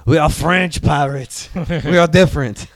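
hammed-up French accent; French pirate. Imagining the pirate crew introducing themselves, mock French accent. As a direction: declare it as a fancy French pirate. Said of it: "weird French pirate crew".

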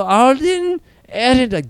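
very high, loud hammed-up German accent. Shown as a demonstration. Repeating actor's name for comedy, exaggerated German accent. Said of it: "super German names".

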